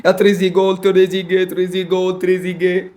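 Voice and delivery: golaso called out like a commentator, fading; goal celebration. Pretend. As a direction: shout the goal then let it die away.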